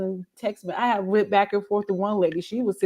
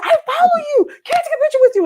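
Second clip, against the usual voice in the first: very high, loud squeal; excited fan; 9 dB louder, much faster than usual. Retold or imitated. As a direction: imitate a fan begging for a photo.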